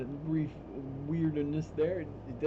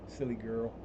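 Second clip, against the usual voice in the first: ordinary delivery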